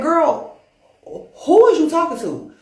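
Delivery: high, loud affronted demand; indignant. Acted. Pissed-off being answering the god sister, indignant. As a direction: draw out the girl, then challenge her loudly.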